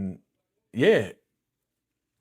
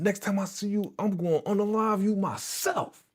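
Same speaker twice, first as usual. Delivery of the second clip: high-pitched, intense retold threat; heated mock menace; acted death threat; 9 semitones higher than usual. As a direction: imitate a man vowing revenge.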